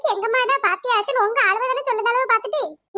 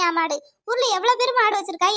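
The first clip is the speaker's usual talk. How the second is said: normal talking voice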